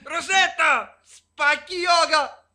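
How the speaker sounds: very loud, high enraged yelling; furious yelling. Acted. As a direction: scream it in blind rage. Husband rages and calls out.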